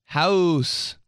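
"House" drawn out with a W glide. Retold or imitated. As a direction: mimic mom's exaggerated "House". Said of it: "says house with a W".

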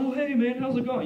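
imitated UPS guy, all friendly; overly friendly deliveryman. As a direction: greet him like your best friend. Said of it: "always so frickin' friendly".

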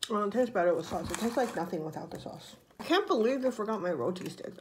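usual speaking voice

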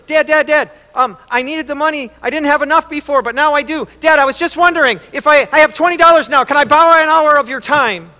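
put-on high, loud, excited child voice; eager little boy. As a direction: burst out eagerly like a kid asking Dad. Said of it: "the little boy's counting his money".

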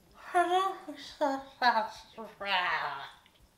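big loud cackle; loud burst of laughter. Real, not acted. Retelling the salon call, a loud burst of laughter.

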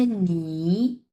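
নি drawn out slowly with a dipping third tone; slow dipping nǐ demo. Shown as a demonstration. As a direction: stretch নি slowly, dipping low then rising. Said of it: "তিন number tone".

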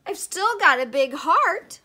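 high, loud self-defence; defiant protest. A narrator's reading. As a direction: insist on it loudly, pitch up. Pluto defends himself to astronomers.

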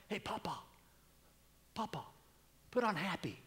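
a hint of begging grandkids; grandkids asking. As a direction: grandchildren pleading from the back seat.

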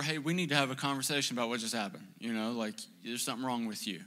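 ordinary talk, nothing marked

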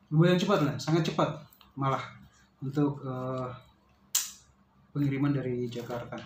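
ordinary delivery

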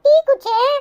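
put-on high-pitched little kid brother voice; little brother, eager. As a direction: chatter up high like a small boy telling his big brother. (உச்ச குரலில் பேசும் குட்டித் தம்பி)

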